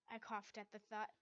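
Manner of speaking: ordinary delivery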